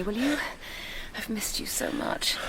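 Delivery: soft whispered plea; intimate whisper. Acted. Asking her lover to hold her, tender whisper. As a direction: whisper it tenderly into his ear.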